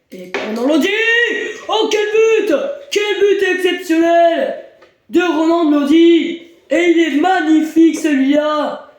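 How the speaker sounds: shouted, high goal call; excited sports commentary. Acted. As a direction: roar the goal like a live commentator.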